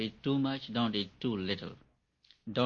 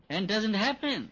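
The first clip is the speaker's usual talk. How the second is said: laughing; 4 dB louder, slower than usual. Real, not acted. Laughs at meditator's futile effort.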